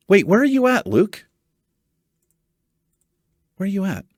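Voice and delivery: usual speaking voice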